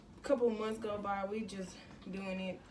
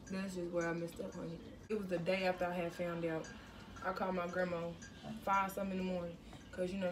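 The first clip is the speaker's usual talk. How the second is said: normal talking voice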